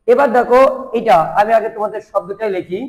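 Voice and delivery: normal talking voice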